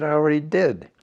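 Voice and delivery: loud, high triumphant retort; defiant. Retold or imitated. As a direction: throw it in his face. Retold heated argument with colonel.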